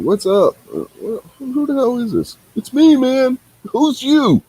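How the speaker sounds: high, puzzled voice, asking who is calling; mock confused phone answer. Friend pretending to answer a phone call from an unknown number, high puzzled voice. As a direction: play the baffled guy picking up a strange call.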